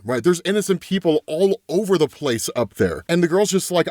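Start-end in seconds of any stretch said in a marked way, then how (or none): none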